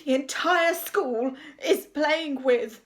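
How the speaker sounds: slow, loud, reluctant build-up; struggling outrage. A narrator's reading. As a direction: force each word out before the dreaded one. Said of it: "he could barely bring himself to say".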